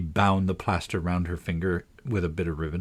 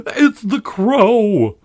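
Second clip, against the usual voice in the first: high, slow, loud cry of alarm; shrill alarm; 12 semitones higher, 9 dB louder, much slower than usual. Acted. Tweedledum warning his brother of the crow, in a loud high alarm. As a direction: screech it out as if the bird is upon you. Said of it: "in a shrill voice of alarm".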